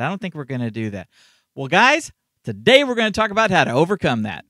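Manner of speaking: peppy promo read. Shown as a demonstration. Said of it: "try a peppier one".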